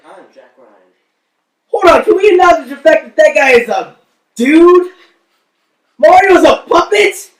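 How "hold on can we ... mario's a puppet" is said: high, loud, incredulous outburst; loud disbelief. Acted. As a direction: blurt it out fast and high, stunned. Stunned that Mario is a puppet.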